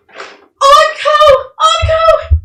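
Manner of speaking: high, loud holler; mother calling out. Acted. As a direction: call your lost child across town. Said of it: "this big old chunky woman".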